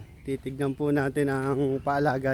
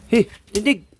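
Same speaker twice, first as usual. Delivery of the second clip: drill sergeant; 5 semitones higher, 6 dB louder than usual. Real, not acted. Commanding pigs to stand.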